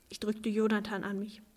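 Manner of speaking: ordinary delivery, nothing marked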